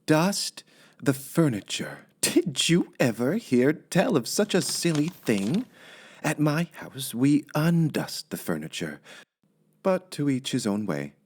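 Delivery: lightly voiced housemaid, musing to herself; a hint of a chatty maid talking to herself; lightly voiced maid, half to herself; Amelia Bedelia's voice. A narrator's reading. Amelia puzzles over the list.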